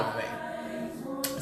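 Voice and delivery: whispered under her breath; whispered aside. Real, not acted. Slipping the viewers a hushed aside, whispered.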